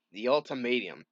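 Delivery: ordinary delivery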